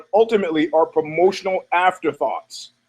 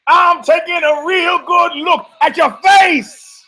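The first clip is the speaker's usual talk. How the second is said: dramatic announcer; 11 semitones higher, 5 dB louder, slower than usual. Acted. Intro bit, dramatic shouted lines.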